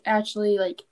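usual speaking voice

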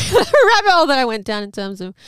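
a real laugh as she remembers how far she went. Real, not acted. Laughing about her deep research.

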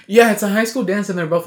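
excited. Real, not acted. Indignant about the dance.

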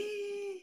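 high, soft gasp; hushed squeaky gasp; shocked gasp. Real, not acted. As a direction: suck in breath at the detail. Shocked at spitting story.

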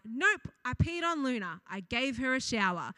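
ordinary talk

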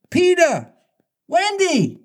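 high calling shout across the water. A narrator's reading.

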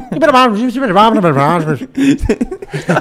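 high, loud, rapid Irish gibberish; mock Irish accent. Imitating angry Irish speech, hammed-up gabbled accent.

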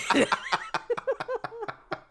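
high, delighted laugh; a real burst of laughter; laughing at his own slip. Real, not acted.

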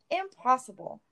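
high, astonished exclamation; cried aloud. A narrator's reading. Mayor seeing the pony, astonished. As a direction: blurt it in disbelief. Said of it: "he cried aloud".

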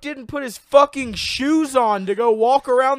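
loud, high-pitched disbelief; incredulous rant. Real, not acted. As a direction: rant as if it's the craziest thing. Incredulous rant about missing shoes.

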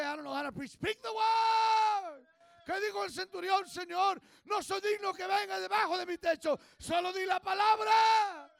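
very high, loud, drawn-out preaching shout; preaching at full force. Real, not acted. Preacher urges the church to speak the word, full shouting. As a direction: cry it out to the back row, slowly.

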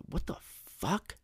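"fuck" stretched out and high-pitched; slow incredulous question leaning on "fuck"; extra oomph. Shown as a demonstration. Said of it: "adds that extra oomph".

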